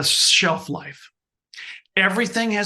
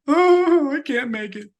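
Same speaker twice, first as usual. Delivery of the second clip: high, loud whine of defeat; mock a quitter throwing in the towel; hammed-up defeated whimper; whiny giving up; 9 semitones higher, 5 dB louder, slower than usual.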